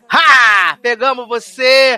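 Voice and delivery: gloating mock shout; hammed-up prank reveal; mock gotcha. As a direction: taunt the fans, slow and loud. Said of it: "falaram assim".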